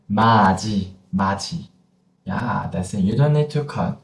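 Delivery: normal talking voice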